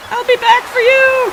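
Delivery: high, loud parting shout; hammed-up villain threat. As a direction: yell the threat over your shoulder like a cartoon villain. Threatening Pierre while leaving.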